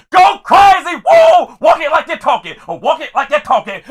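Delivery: loud, high whooping cheer; yelling down aisle. Retold or imitated. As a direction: holler encouragement at each passing graduate. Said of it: "I'm yelling at everybody down the aisle".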